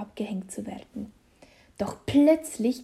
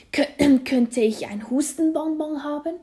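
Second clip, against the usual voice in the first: higher request broken by throat-clearing; hacking, polite plea; coughing; 5 semitones higher, 4 dB louder than usual. A narrator's reading. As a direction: stumble over the words between coughs. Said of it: "hustet und sagt zu Nick".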